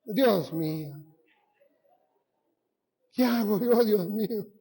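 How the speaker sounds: a mother-in-law's mock horrified groan; half-joking exasperated sigh to God, slowed down; mock dismay. Preacher voicing his mother-in-law, mock despair at his skinny legs. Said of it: "me miró a mí y dijo".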